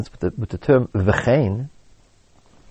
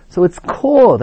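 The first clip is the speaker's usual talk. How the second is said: called punched higher and louder; stress on called; 6 semitones higher, 5 dB louder than usual. Shown as a demonstration. Teacher explaining the Ramban, stressing called. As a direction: lean hard on the word called. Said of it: "I'm stressing the word called".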